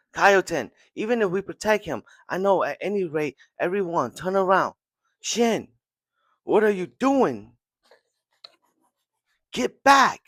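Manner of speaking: high, loud pleading orders; desperate commander. Retold or imitated. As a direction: call him back, urgent and rising. Kaioten yelling orders to Shin.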